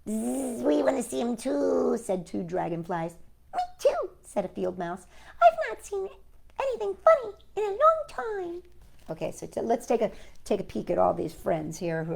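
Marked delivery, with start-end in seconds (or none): 0.1-2.0 higher buzzy chorus; buzzing dragonflies. Acted. Dragonflies joining in, buzzing. 3.5-4.1 very high squeaky mouse; tiny mouse voice. Acted. Field mouse piping up very high. 5.4-8.6 very high squeaky mouse; tiny mouse voice. Acted. Field mouse piping up very high.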